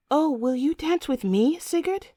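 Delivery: put-on high, hopeful young bride-to-be; girlish high-pitched request; eager girl. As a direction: plead sweetly, rising high at the name. Girl pleads for a dance.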